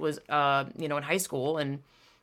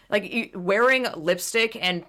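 usual speaking voice

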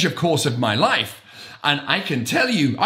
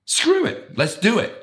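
Richard Branson quote; 8 semitones higher than usual. Retold or imitated. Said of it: "in the words of Richard Branson".

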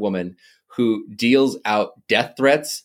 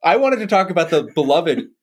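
raised, playful exasperation; mock outrage; 8 semitones higher than usual. Real, not acted. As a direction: complain in mock despair. Mock outrage at podcast turn.

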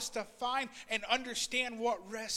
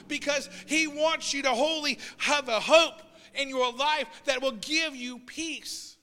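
raised, louder preaching voice, pressing the hope home; fervent preacher urging the congregation; preaching at full force; 5 semitones higher, 7 dB louder than usual. Acted. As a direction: build the sermon to a passionate peak.

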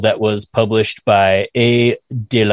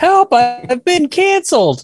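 high, whiny mock plea; mock cry for help. Joking about being canceled, a high mock plea for help. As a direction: squeal it like a pretend victim.

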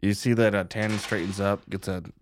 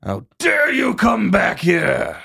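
slow, high, loud furious bellow; enraged big man yelling at his brother; angry shout; 8 semitones higher, 7 dB louder, slower than usual. Acted. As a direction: roar it across the room at him. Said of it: "He's like".

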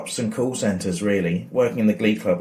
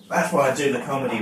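ordinary talk

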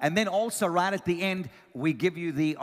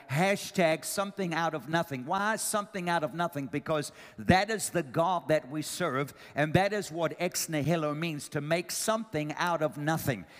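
ordinary delivery

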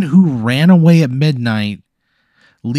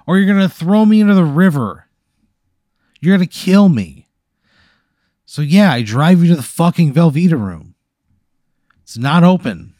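louder, higher panicked outburst; frantic rant; 4 semitones higher, 5 dB louder than usual. Acted. Friend rants at the ghost passenger, loud and frantic. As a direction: spill out every fear at once.

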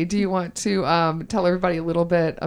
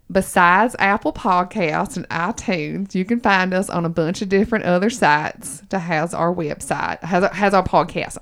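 put-on Southern accent. Host tells listeners where to find them in a Southern accent. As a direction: plug the podcast in a Southern accent. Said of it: "I have to go back into it".

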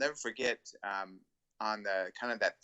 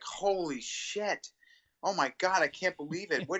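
starstruck fan; 8 semitones higher than usual. Retold or imitated. Fan at the airport gushing on meeting Dean, high and awestruck. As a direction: play a fan who can't believe his eyes. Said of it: "he's looking at me like".

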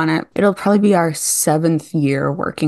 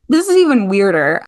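ordinary delivery

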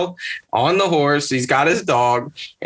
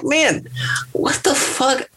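much higher-pitched, incredulous outburst; baffled exclamation; 12 semitones higher, slower than usual. Retold or imitated. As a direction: voice jumping up in disbelief. Own baffled reaction, voiced.

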